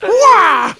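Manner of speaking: loud, high yelp of a sword swing; mock battle cry. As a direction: shout it like striking a blow. Acts out the sword blow.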